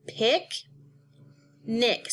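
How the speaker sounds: "Pick, nick" as two separate beats; long pause between "Pick" and "nick"; syllable by syllable. Shown as a demonstration. Teacher showing two syllables, "Pick, nick" broken apart. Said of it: "This word has two syllables".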